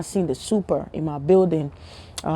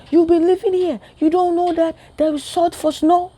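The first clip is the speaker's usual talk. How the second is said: much higher, incredulous mom voice; teasing mom; 11 semitones higher than usual. Retold or imitated. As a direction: mom can't believe he didn't know. Mom scolds him in story.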